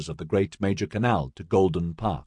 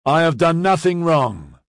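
loud, higher defiant call; shout back; 9 semitones higher, 4 dB louder than usual. A narrator's reading. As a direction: gang leader shouting back at the sergeant, defiant. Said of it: "Parkland shouted back".

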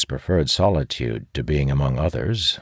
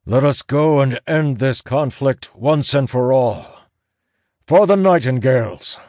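rallying shout; 10 semitones higher, 5 dB louder than usual. A narrator's reading. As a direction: rouse the crowd, ending on a loud cry. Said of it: "Elias shouts".

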